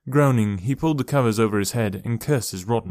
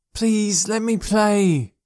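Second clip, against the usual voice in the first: put-on child begging; 11 semitones higher, 3 dB louder, much slower than usual. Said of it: "he'd beg".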